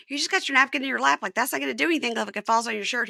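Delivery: high, fussy scolding wife voice. Retold or imitated. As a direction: scold him about the napkin. Wife fussing over napkin.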